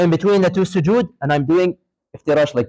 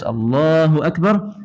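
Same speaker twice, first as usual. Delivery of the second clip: slow "Allahu Akbar" with a long middle vowel; drawn-out takbir; slower than usual. Shown as a demonstration. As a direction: stretch "Allahu" through the movement, lifting at the end. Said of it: "And I do this".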